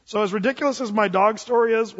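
ordinary talk

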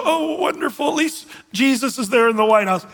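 high, naive mock relief; mock gushing believer. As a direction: send up the easily pleased believer. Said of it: "we should go".

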